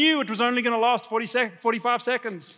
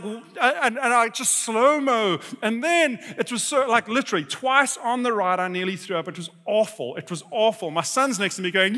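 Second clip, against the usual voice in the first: ordinary talk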